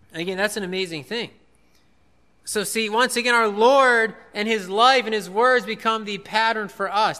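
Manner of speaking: normal talking voice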